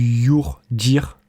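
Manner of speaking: brand name in a clumsy English accent; French speaker's slow stab at English pronunciation; poor English accent. Real, not acted. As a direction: attempt the English pronunciation, drawn out slowly. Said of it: "excusez-moi de mon accent anglais".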